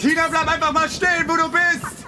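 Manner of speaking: calling out. Acted. Calling through the fog.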